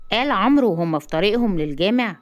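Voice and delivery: usual speaking voice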